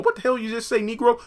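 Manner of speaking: high-pitched mock shock; high, startled "what did you just say"; shocked retort. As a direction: squeak out the outrage. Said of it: "has you going".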